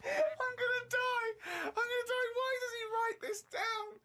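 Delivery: high, tearful squeal of laughter; helpless laughter. Real, not acted. Co-host overcome by the ugly duck entry, helpless laughter. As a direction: wail the words while laughing too hard to cope.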